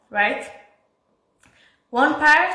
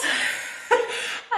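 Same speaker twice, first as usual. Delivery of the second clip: high breathy laugh; 10 semitones higher, 4 dB quieter than usual. Real, not acted. Laughing at the memory of panicking before classmates. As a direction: burst of high, airy laughter. Nervous laugh after shock.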